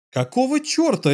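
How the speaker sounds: barking sergeant. A narrator's reading. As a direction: bark it at the soldier like an angry sergeant. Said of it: "рявкнул сержант".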